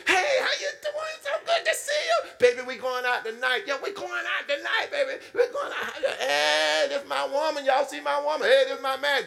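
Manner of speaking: hammed-up laughing brag about my woman; overjoyed greeting. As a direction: show off your partner to everyone, beaming. Acting happy partner showing off.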